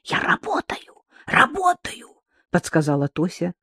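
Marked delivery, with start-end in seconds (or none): 0.0-2.1 high whisper; whispered prompt. Acted. Girl prompting words secretly.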